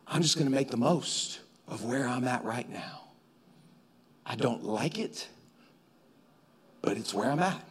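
low, hushed and measured; soft, low, patient resolve; quiet acceptance. Acted. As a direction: settle into it softly, with long pauses. Voices patient, resigned acceptance.

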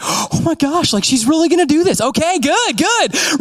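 high, giddy relief; excited groom. Retold or imitated. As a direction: gasp in happy disbelief at the aisle. Nervous groom watching bride.

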